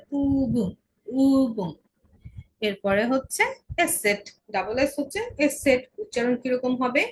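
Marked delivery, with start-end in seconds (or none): 0.1-1.7 "উবুম" with a long, rounded first vowel; u-umlaut demo. Shown as a demonstration. Teacher giving a u-umlaut example, long first vowel. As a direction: stretch the opening vowel of "উবুম". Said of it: "umlaut হচ্ছে".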